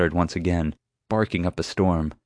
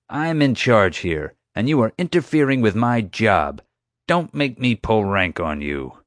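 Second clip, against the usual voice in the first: domineering security chief; 4 semitones higher than usual. Acted. Security chief warns the adviser off, domineering. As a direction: assert rank, weighing each word. Voices chief threatening to pull rank.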